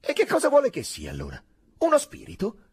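high, loud, testy questions; irritated retort. A narrator's reading. Lieutenant challenges his sergeant's doubts, irritated. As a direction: throw the question back impatiently. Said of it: "fece Drogo vagamente irritato".

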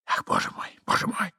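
breathy, soft lament; soft, anguished half-whisper; hushed despair. Acted. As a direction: moan it under your breath. Hidden Ivan despairs.

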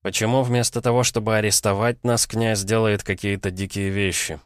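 usual speaking voice

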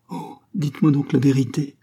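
plain reading voice

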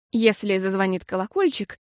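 usual speaking voice

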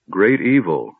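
ordinary delivery

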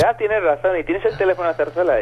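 the caller cheerfully agreeing; female prank caller. Retold or imitated. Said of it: "Y dice". (voz aguda de la bromista dando la razón)

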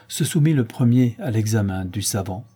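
ordinary delivery, nothing marked

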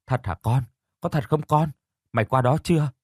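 mildly alarmed, pitch lifting on the repeat; flustered questioning. Acted. Mother anxiously checking her child's news, flustered. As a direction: ask urgently, a touch of panic. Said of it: "hớt hơ hớt hải hỏi lại tôi".

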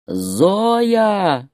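drawn-out angry roar of the name; bear-like roar. A narrator's reading. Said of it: "ревел, как медведь". (рёв медведя)

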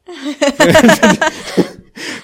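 loud, high-pitched laugh; burst of laughter. Real, not acted. Laughing at own impression of the TV personality, high and loud. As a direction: crack up loudly.

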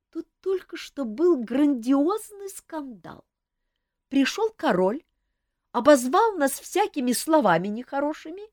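high, unhurried gossip voice; lazy gossiping lady. A narrator's reading. Камила лениво рассказывает дамам о скандале, высоким голосом.

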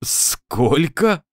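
startled, breathless question; gasping surprise. Acted. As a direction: gasp out the question in shock. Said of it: "Анатолий ахнул". (изумлённо, на выдохе)

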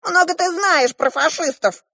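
high-pitched dwarf yell; shouting dwarf. A narrator's reading. The dwarf snaps back at the girl, shouting. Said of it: "закричал на меня карлик".

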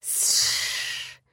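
soft unvoiced snake hiss; a confused little hiss; puzzled hiss. A narrator's reading. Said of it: "недоуменно отозвался Вейр". (недоумённое шипение)

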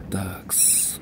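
very soft, low filler word; resigned muttering. Acted. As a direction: let it out under the breath.

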